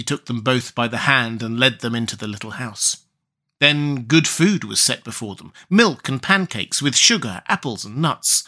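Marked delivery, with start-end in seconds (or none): none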